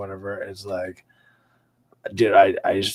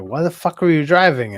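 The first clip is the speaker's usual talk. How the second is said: higher, louder demanding friend; retold yell; 8 semitones higher, 7 dB louder than usual. Friend scolding him for driving, higher and louder. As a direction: demand an answer, half mock outrage.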